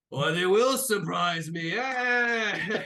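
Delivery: loud, high, drawn-out cheer; delighted cheer. Acted. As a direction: burst out impressed as a big captain. Kong cheers the acrobats' stunt.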